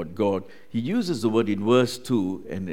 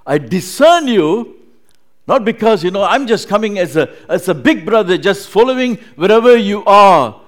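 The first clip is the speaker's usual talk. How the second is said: loud, higher fervent preaching; loud, impassioned voice of God; loving proclamation; 9 semitones higher, 15 dB louder than usual. Acted. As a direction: proclaim it with full force. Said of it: "not the spirit or the tone".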